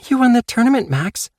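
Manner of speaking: high, loud excited announcement; Sarah excited. Acted. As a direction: burst out the good news.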